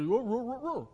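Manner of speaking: put-on boy mumbling with worms in his mouth; mumbled through a full mouth. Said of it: "he just sort of mumbled at him".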